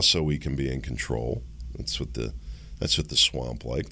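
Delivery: normal talking voice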